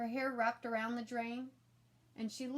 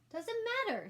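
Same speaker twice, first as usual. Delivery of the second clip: put-on much higher, louder fussy kid voice; insistent little brother; 13 semitones higher, 5 dB louder than usual. A narrator's reading. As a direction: insist like a know-it-all child.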